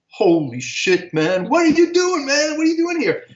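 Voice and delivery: slow amazed start leaping very high; acted-out delighted shock at a reunion; astonished recognition. Saxophonist recognizing childhood friend backstage, astonished.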